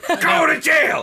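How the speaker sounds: high shouted mock sentence. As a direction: yell it like a joke judge. Mock sentencing, repeated louder.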